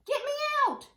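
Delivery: put-on high childish shriek; high, loud shout to get out. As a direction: squeal it from the shopping cart. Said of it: "I shouted".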